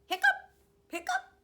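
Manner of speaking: high, loud squeaky hiccup sounds. Acted. Reading a story to kindergarteners, high loud hiccups. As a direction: hiccup it out like a big dog.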